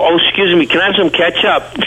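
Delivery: put-on high-pitched New York accent. Young New Yorker asking the waitress for ketchup, New York accent. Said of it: "With my New York accent".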